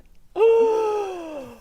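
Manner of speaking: long, high, loud gasp; mock shocked gasp. Reacting to drought news in Ireland, exaggerated horrified gasp. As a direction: gasp as if scandalised.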